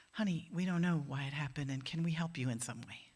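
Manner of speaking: put-on tender words to a grieving child; gentle comfort. Comforting an orphaned refugee girl.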